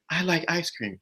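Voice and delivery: soft, suggestible repeat; obedient echo. Acted. Woman repeating what she was told, softly obedient. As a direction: repeat it softly, as if just persuaded.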